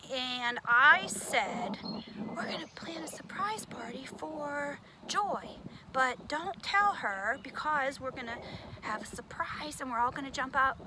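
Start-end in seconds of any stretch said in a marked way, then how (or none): 1.8-10.8 unvoiced conspiratorial whisper. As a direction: whisper the secret plan. Secret plan told in whisper.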